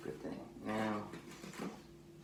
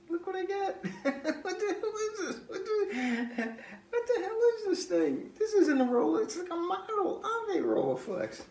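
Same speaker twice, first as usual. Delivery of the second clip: much higher, louder incredulous outrage; exasperated outrage; 5 dB louder than usual. Real, not acted. Ranting to viewers about the toy camera, outraged. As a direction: let the disbelief burst out at the fake camera.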